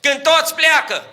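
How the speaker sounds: preacher thundering to the congregation about tears; preaching at full force. Acted. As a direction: lift the church with a ringing sermon. Preacher climaxes.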